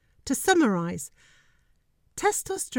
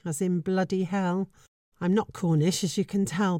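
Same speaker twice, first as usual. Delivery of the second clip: usual speaking voice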